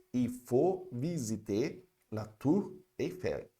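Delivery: slow, careful French for repeating; slow model sentence. Shown as a demonstration. Teacher modelling French for students to repeat, slowly. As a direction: spell out each word slowly for the class. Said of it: "Repete comigo mais uma vez".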